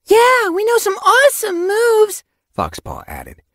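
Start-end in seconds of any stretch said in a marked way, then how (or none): 0.1-2.2 put-on very high, loud young voice; eager boasting. A narrator's reading. Apprentice begs to join the battle, boasting. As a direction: chime in excitedly, high-pitched.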